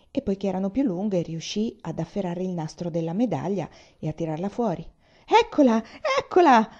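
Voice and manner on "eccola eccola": much higher, louder excited croak; old man croaking as his medal is pulled out; croaking with glee. Acted. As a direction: cackle it out like a greedy old man. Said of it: "gracchiò il vecchio".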